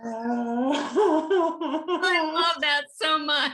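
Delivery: drawn-out laughing gush; helplessly tickled, words stretched out; delighted laughing. Real, not acted. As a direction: gush through a long laugh. Delighted at dog fingernails.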